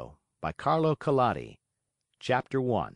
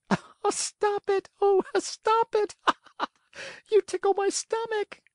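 giggling tiny voice. A narrator's reading. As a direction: squeal while being tickled. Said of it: "it giggled as it spoke".

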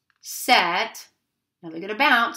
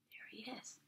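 soft unvoiced whisper; 2 semitones higher, 25 dB quieter than usual. Real, not acted. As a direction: hush it out. After whispering scene.

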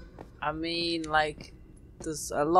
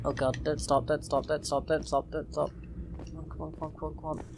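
tense hushed; 4 semitones lower, 9 dB quieter than usual. Real, not acted. Urging himself on while fleeing.

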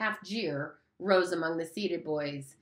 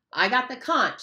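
mildly indignant, higher and louder; 7 semitones higher, 6 dB louder than usual. Acted. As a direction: insist on your right to speak. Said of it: "said Piggy indignantly".

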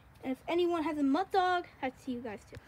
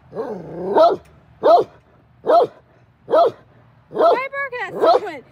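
scolding the dog; 7 semitones higher, 13 dB louder than usual. Real, not acted. Scolding dog barking at someone.

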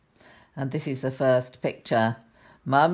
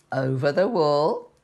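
usual speaking voice

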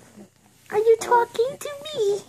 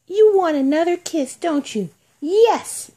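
usual speaking voice

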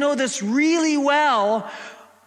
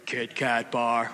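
very low, slow jingle ending; deep, drawn-out manly jingle voice; 12 semitones lower, 7 dB quieter, slower than usual. Shown as a demonstration. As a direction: drop way down for a manly bass. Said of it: "you gotta go manly".

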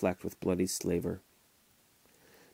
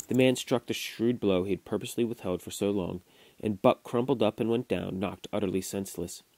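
ordinary delivery, nothing marked

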